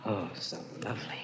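very soft, low, menacing intimacy; hushed and low, lingering with long pauses; entranced murmur. Acted. Entranced husband fondles wife's throat.

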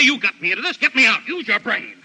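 high, loud and desperate; panicked shout. Acted. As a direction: yell for help in panic. Editor panics.

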